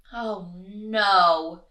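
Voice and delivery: loud, stretched groan of dismay; lightly voiced fed-up Mister Bear; exasperated bear. A narrator's reading. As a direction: moan it in storybook despair. Storybook bear fed up with snoring.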